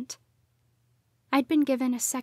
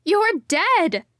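shouted out, higher and louder; bursting out; 7 semitones higher, 7 dB louder than usual. A narrator's reading. Daughter shouting the truth at her parents. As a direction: blurt it out at full force. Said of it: "I burst".

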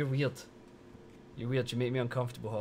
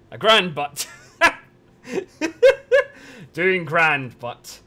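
grand butt called out loud and high; loud mock announcement; 7 semitones higher, 13 dB louder, much slower than usual. Repeats viewer's typo loudly.

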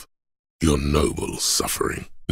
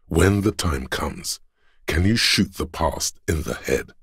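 usual speaking voice

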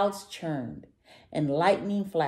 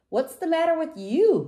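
munching; 12 semitones higher, 7 dB louder than usual. A narrator's reading. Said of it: "munched Velo".

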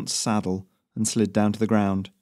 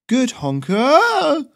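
drawn-out teasing call; 8 dB louder, much slower than usual. Acted. Teasing shout before barrel roll.

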